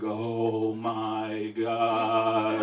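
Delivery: slow, sung-out oh my God; eerie drawn-out realisation; dawning dread. Retold or imitated. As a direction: stretch it out like a trip kicking in. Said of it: "And then I was like".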